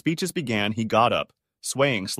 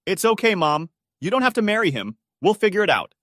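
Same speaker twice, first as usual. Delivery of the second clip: higher, louder insistent comfort; urgent reassurance; 9 semitones higher, 5 dB louder than usual. A narrator's reading. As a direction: raise your voice to reach her. Comforting sobbing mother.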